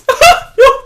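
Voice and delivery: loud shrill put-on giggle; loud parody laugh; mock high laugh. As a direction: cackle like a smug prick. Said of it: "like a prick".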